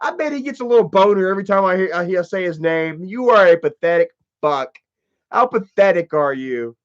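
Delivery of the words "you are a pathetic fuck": ordinary delivery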